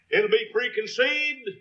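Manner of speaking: ordinary delivery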